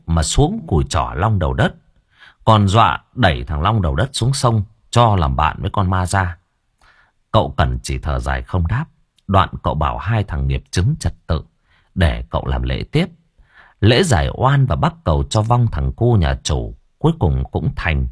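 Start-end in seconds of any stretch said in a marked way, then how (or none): none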